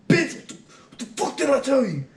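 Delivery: high, loud retold outburst; sputtering, outraged dressing-down; pissed-off drill sergeant. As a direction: imitate a sergeant blowing his top. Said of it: "he got so pissed".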